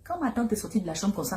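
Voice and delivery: ordinary talk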